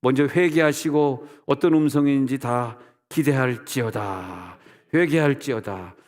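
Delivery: loud, slow preacher's proclamation; ringing call to repentance; solemn exhortation. Real, not acted. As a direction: proclaim it to the congregation, stretching the endings.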